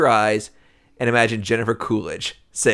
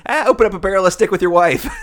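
higher, acted cartoon worker; raised-pitch dismissive character voice; mock brusque reply; 7 semitones higher, 4 dB louder than usual. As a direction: toss back a hammed-up kitchen order. Other worker answers in the sketch.

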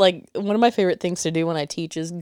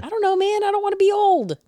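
high-pitched protesting whine; mock whining. Playful protest about getting older.

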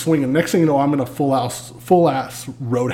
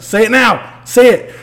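higher, louder insistence; demanding yell; 9 semitones higher, 7 dB louder, slower than usual. Retold or imitated. To the officer who drew his gun, a loud insistent demand. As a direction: push hard for the apology. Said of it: "And I was like".

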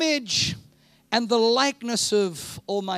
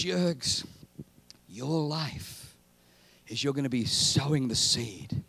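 gentle voice of God; 9 semitones lower than usual. Pretend. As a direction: speak it like a loving father.

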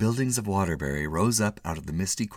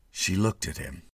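usual speaking voice

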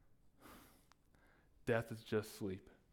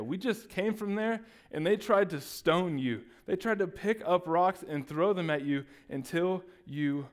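higher, louder alarmed pleading; alarmed protest; 7 semitones higher, 6 dB louder than usual. Acted. Disciples protest to Jesus about returning, alarmed.

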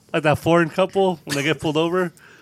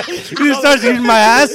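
laughing, excited; 6 semitones higher, 7 dB louder than usual. Real, not acted. Crude joke, laughing escalation.

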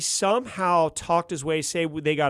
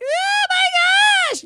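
very high, loud mock scream, drawn out slowly; imitated scream; 9 dB louder, much slower than usual. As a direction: shriek it like a terrified guy. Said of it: "he was screaming".

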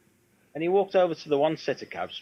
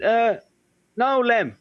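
higher, louder, timid "no, Lem"; nervous reply; 9 semitones higher, 5 dB louder, slower than usual. Retold or imitated. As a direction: answer like a cornered engineer. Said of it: "And he went".

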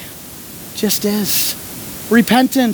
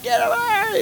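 high-pitched yell of a frantic coach; retold yell; 13 semitones higher, 3 dB quieter than usual. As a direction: shoot it up high, brushing someone off. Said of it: "Probably I was just sitting there very calmly".

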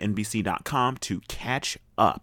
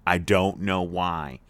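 slow, low, word-by-word puzzlement; deliberate repeat; 6 semitones lower, slower than usual. Real, not acted. Host admits a weakness for British accents, deliberately. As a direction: spell it out slowly and low.

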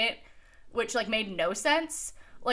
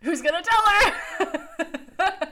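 high, loud, drawn-out laughing question; mock class dilemma; 9 semitones higher, 4 dB louder, slower than usual. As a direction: stretch it out high, hammed up. Voices the class's awkward reaction.

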